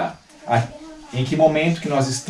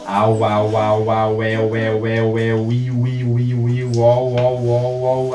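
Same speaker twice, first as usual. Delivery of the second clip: lower, drawn-out warm-up syllables repeated; vocal warm-up drill; 3 semitones lower, much slower than usual. Shown as a demonstration. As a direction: run through the voice exercises like a diction class. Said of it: "existe uma série de exercícios vocais".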